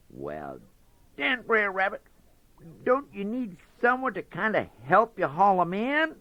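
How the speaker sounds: high, faltering fox voice; hesitant Brer Fox. Acted. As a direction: stammer it out, curious fox.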